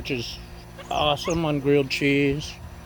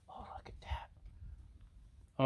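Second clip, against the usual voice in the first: slow hushed whisper; 20 dB quieter, much slower than usual. Real, not acted. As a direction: whisper it like a secret. Close-up of sizzling food.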